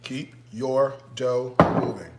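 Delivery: each word set apart by pauses; word-by-word emphasis. Real, not acted. Cook drilling viewers on the dough, word by word. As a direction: space out keep, your, dough, moving. Repeats key instruction word by word.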